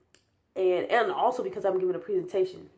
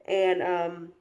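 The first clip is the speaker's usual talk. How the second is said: words stretched by a yawn; real yawn. Real, not acted. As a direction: talk through a real yawn. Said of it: "yes, that was a yawn".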